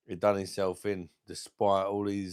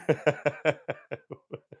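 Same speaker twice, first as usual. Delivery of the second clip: high, loud cackling laugh; loud burst of laughter; 7 semitones higher, 5 dB louder than usual. Real, not acted. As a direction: break into a big laugh. Laughs at his own joke.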